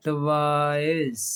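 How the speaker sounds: "टॉयज" drawn out very slowly, ending on a clear z. Shown as a demonstration. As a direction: stretch the final z of "टॉयज". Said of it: "जे की आवाज़ आती है". ("टॉयज" को बहुत धीरे, आखिर में ज़ की आवाज़ के साथ)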